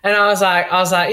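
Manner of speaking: usual speaking voice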